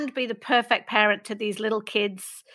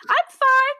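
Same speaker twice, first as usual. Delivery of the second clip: bright, much higher put-on cheer; mock cheery "I'm fine"; 5 dB louder than usual. Faking brightness to hide the tears, high chirpy "I'm fine". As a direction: brush it off with a chirpy lie. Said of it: "where you're like".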